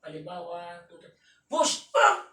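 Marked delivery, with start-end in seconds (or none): 1.5-1.9 sudden high, loud push call; coach shout. Real, not acted. Coach urging kuya through a rep, loud high shout. 1.9-2.3 sharp high strained yell; effort cry. Real, not acted. Cheering kuya's effort with a sharp high cry.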